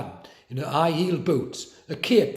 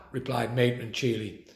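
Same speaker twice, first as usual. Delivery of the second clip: plain reading voice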